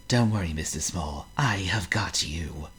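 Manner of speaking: soft slow whispered comfort; gently calm him as you hold on; reassuring. Acted. Mister Calm catching Mister Small, soft slow whisper.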